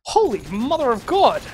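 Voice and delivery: awed exclamation at the clutch; stunned. Real, not acted. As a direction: gasp it out in disbelief. Caster stunned by clutch play.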